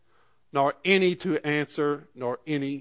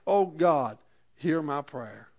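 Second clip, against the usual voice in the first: settled plea, easing softer at the end; simple trusting prayer; 4 dB quieter, slower than usual. Acted. Preacher shows bringing a prayer to God, calm simple plea. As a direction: pray it once, calmly, letting it fall. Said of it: "we can come to God".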